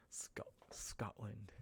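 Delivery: normal talking voice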